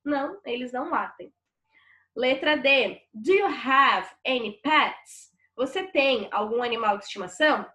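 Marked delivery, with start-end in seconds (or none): 3.1-5.3 slow, deliberate English sentence; slow classroom reading of the English question; slow model reading. Shown as a demonstration. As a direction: read it slowly so students follow. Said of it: "teacher reads exercise sentence aloud".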